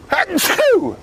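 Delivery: loud sudden sneeze; a big real sneeze; sneezing. Real, not acted. Sneeze amid talk of sneezes.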